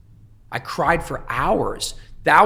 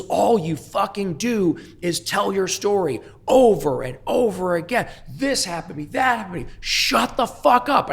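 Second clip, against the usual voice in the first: angry yelling; 9 semitones higher, 3 dB louder than usual. Retold or imitated. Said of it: "he's red in the face screaming at me".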